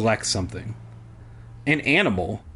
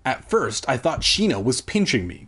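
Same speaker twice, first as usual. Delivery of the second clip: usual speaking voice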